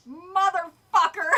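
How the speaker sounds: loud, drawn-out curse; real exasperated swearing at full volume; exasperated outburst. Real, not acted. Exasperated at the story's ending.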